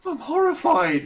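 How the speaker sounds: very high, loud mock horror; guard reacting to the slaughtered horse, mock-horrified. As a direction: squeak out the horror.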